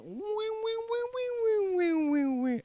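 high, thin mock car engine whining uphill; playful imitation of an old car's engine straining; engine whine. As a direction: whine it out like a struggling little motor. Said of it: "we would just whine our way".

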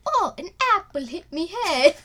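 high, hammed-up accent as Isaac Newton; mock accent of uncertain origin. Co-host playing Newton bonked by the apple, mock accent. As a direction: cry out in a silly put-on accent. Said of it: "I don't know what accent that was".

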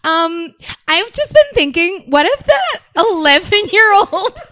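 loud, high-pitched mock video intro; mock vlogger. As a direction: parody yourself pitching to camera, loud and high. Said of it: "it just looks like I'm like".

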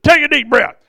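sudden loud shout to startle her; scare shout. Retold or imitated. Preacher jolting a woman in the prayer line, loud shout. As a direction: bark it out to jolt her. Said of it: "and scared her".

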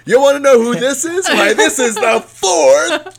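loud, high showman announcing; carnival barker. Acted. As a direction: drum up the crowd like a barker. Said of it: "like carnival sideshow Barker walking up like".

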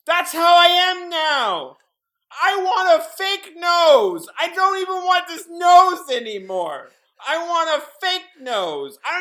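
high, loud and crazed; unhinged shouting. Acted. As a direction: yell it like a madman. Unhinged rant continues.

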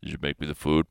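low, quiet, demanding growl after the gym; a gruff caveman wanting dinner; deep aggressive growl. Retold or imitated. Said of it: "My voice is, like, four octaves lower. I'm very aggressive".

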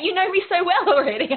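laughing through quick words; amused, laughing aside. Real, not acted. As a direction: giggle it out as a joke. Joking with host, laughing.

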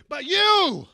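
very loud, high exclamation; sudden outburst. Retold or imitated. Marcos crying out at the man bringing food, loud outburst. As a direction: explode on the words. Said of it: "and he goes".

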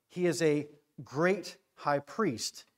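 emphatic, drawn-out declaration; stress on great. Shown as a demonstration. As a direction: stretch "great" and land the line. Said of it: "Emphasis on the word great".

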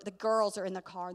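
usual speaking voice

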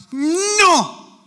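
put-on loud, high, stretched toddler no; two-year-old refusal. Camper objecting to a hard mattress, loud toddler-like refusal. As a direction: wail out a mock childish refusal. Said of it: "our two-year-old".

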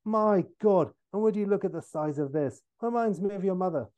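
lightly voiced cheeky old rogue; Michael Caine. Father swallowed up by fissure, cheeky parting exclamation. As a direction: exclaim first, then toss off the joke. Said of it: "as he says".

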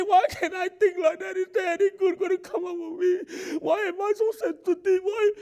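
high, loud voice breaking into sobbing questions; mock crying. Preacher voicing a hurting believer's crying questions. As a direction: cry it out like someone despairing.

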